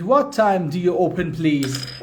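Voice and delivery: slow, clear English model line; teacher says it slowly for the class to translate; slow model sentence. Shown as a demonstration. Teacher reading a phrase slowly for students to translate. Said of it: "اینو ترجمه بفرمایید".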